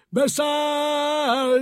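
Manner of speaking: high, loud, long drawn-out conductor call. A narrator's reading. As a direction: call all aboard across the platform. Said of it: "a kalauz nagyot kiáltott".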